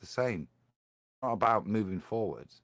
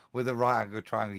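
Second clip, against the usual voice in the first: usual speaking voice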